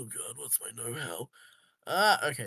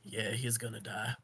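muttered aside; 3 semitones lower, 7 dB quieter than usual. Acted. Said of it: "wait until he's out of earshot".

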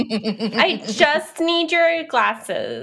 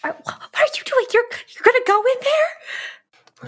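high, urgent disbelief; alarmed questioning; 13 semitones higher than usual. Acted. [name] stopping a friend from going in, high alarmed question.

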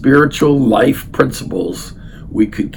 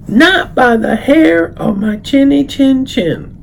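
much higher, slow pig voice; little pig; 6 dB louder, slower than usual. Acted. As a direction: squeak a cheeky refusal. Third pig answers the wolf.

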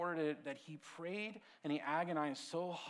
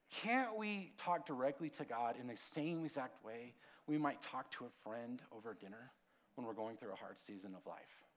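ordinary talk, nothing marked

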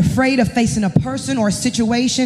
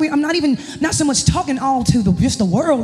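ordinary delivery

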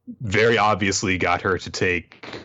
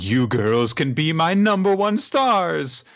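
raised, lightly voiced creepy kidnapper; 7 semitones higher, 3 dB louder than usual. Kidnapper addressing his shrine of victims, lightly hammed-up. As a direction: play the gloating captor, pitched up. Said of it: "And he's like".

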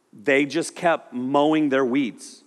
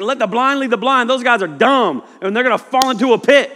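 higher, louder, blunt and dismissive; blunt Jesus; 8 semitones higher, 7 dB louder than usual. Retold or imitated. Preacher voicing Jesus dismissing the Pharisees, loud and blunt. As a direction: bark out the verdict on the Pharisees. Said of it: "He's like".

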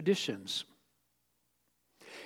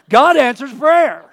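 loud, slow and higher; triumphant shout; 7 semitones higher, 13 dB louder, slower than usual. Acted. Preacher shouts the mother's conclusion in triumph. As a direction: declare it in triumph.

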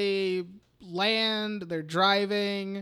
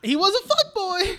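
high, loud singsong verdict; high-pitched insistence; 13 semitones higher, 6 dB louder than usual. Real, not acted. Defending a joking verdict on him, high-pitched insistence.

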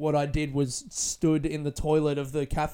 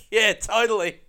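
loud agreement; 6 semitones higher, 8 dB louder than usual. Retold or imitated. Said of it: "and I was like".

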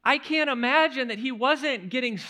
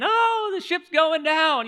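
higher, louder, slow mock lament; mock wailing panic; 7 semitones higher, 6 dB louder than usual. Preacher imitating fishermen crying the ship is sinking, slow mock wail. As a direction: act out the fishermen's wailing, drawn out. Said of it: "crying and wailing and, and saying".

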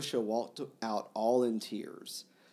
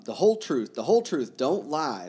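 higher, louder desperate insistence; urgent loud plea; 4 semitones higher, 9 dB louder than usual. Acted. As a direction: plead hard for the truth. Mitya pleading in read dialogue.

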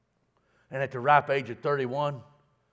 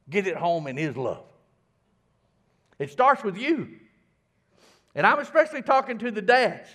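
higher, louder, building sermon delivery; fervent preacher's exhortation; preaching; 9 semitones higher, 9 dB louder than usual. Acted. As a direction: drive it home to the congregation. Preaching at full force.

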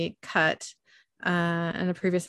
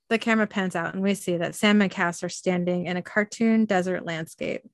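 usual speaking voice

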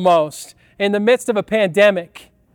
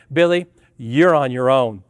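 much lower, deadpan mock voice of the mother; mock mom; 9 semitones lower than usual. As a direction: drop the voice low as if mom bails on him. Mom abandoning him on ride.

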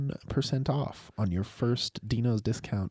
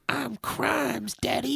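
put-on high-pitched little kid voice; put-on high child voice; mobster's kid; 12 semitones higher, much slower than usual. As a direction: squeak it like a child playing gangster. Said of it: "his kid's fucking like".

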